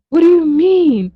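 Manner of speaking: high, loud disbelief; indignant retort. Retold or imitated. Retorting to friends who tease her about haunted houses, indignant.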